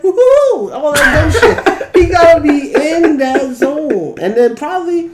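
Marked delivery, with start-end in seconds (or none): 0.0-0.7 Pooh-like woohoo. Retold or imitated. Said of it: "can't talk in a regular voice".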